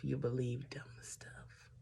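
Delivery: slow, soft whispered confession; whispered aside. Real, not acted. Telling viewers what love makes you do, whispered. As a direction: whisper it slowly like a rueful confession.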